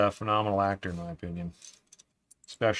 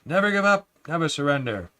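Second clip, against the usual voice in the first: high-pitched hammed-up film hero catchphrase; Galaxy Quest quote; 5 semitones higher than usual. Streamer quotes Galaxy Quest to his chat, hammed-up heroic. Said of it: "Isn't that, uh, Galaxy Quest?".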